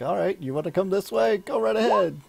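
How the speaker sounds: goading invitation. Acted. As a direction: egg him on to go ahead. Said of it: "so I'm like".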